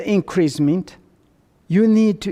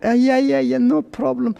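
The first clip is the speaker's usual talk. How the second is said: mock eager seller; 7 semitones higher than usual.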